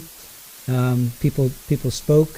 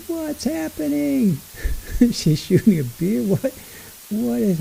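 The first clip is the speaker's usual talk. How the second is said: baffled; 9 semitones higher than usual. Real, not acted. Baffled reaction to trial clip.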